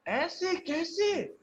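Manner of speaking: "ऐसे कैसे" stretched slow with a big high leap on "ऐसे"; high slow disbelief. Shown as a demonstration. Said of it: "stressing कर रहे हैं". ("ऐसे" को बहुत ऊँचा और खींचकर, हैरानी में)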